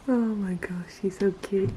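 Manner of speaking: soft breathy whisper. Real, not acted. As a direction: whisper so the puppy sleeps. Whispering over sleeping puppy.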